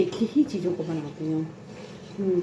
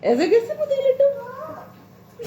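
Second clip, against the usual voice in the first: 1 mocking aunt; slower than usual. बुआ की नकल, ऊँची खिंची आवाज़.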